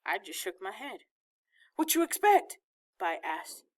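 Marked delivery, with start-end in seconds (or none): 1.8-2.6 put-on indignant boy. A narrator's reading.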